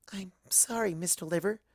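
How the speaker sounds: soft guilty whisper; hushed little apology. A narrator's reading. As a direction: whisper it as if sorry to the glass. Fluttershy apologizes to her drink.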